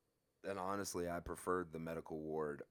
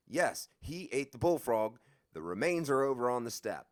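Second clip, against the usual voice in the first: louder and higher, stubborn; insistent; 7 semitones higher, 9 dB louder than usual. Retold or imitated. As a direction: insist he saw the frog. Insisting about the frog.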